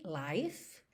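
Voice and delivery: "life" ending cleanly on f, no extra e; soft "life" ending on f; final e dropped. Shown as a demonstration. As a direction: say "life" with no vowel after the f. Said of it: "não existe life".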